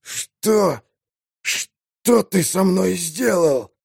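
slow strained wheeze; wheezing. Acted. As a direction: gasp it out in pain. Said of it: "захрипел".

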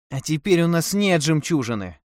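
high plaintive moan; moaning lament. A narrator's reading. Wife bemoaning the lost pearl to her husband, moaning. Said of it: "простонала Тефара".

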